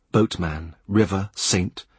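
ordinary delivery

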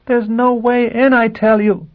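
high despairing cry, slow. Acted. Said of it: "she cried in despair".

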